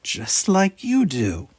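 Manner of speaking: high, slow blurted remark; eager, tactless cry; cried out. A narrator's reading. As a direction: blurt it out without thinking. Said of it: "cried Shadosa".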